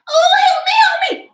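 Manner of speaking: very high, loud squeaky cries for help; a tiny trapped fairy shrieking; high-pitched fairy wail; tiny fairy crying for help. Acted. Fairy stuck, calling for help.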